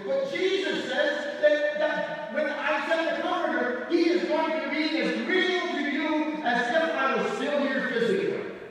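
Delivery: loud, high-pitched sermon delivery; full-force preaching. Real, not acted. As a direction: preach it out with rising intensity.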